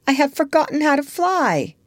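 high-pitched, mildly regretful confession; understated apology, pitched high; apologetic. A narrator's reading. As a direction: admit it with an apology. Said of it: "she said apologetically".

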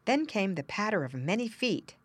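plain reading voice

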